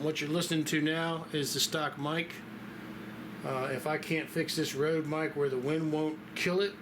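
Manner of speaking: ordinary talk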